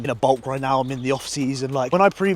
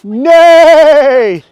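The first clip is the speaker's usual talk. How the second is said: loud, high neighing imitation of a horse; whinny like a horse to greet it; 14 semitones higher, 17 dB louder than usual. Greeting the horses in the field, a high loud neigh.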